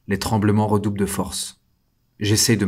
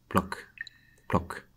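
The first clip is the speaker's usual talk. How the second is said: soft, fading drip sounds, each one quieter; dripping water; 8 dB quieter than usual. Acted. Prisoner hearing water drip in his cell, soft fading imitation. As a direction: mimic water drops falling in the dark.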